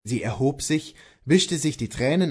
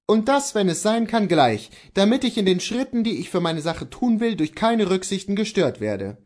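resolute, higher-pitched husband; Kohlhaas the husband; 8 semitones higher, 4 dB louder than usual. Acted. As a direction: insist firmly to your wife.

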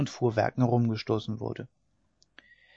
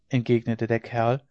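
usual speaking voice

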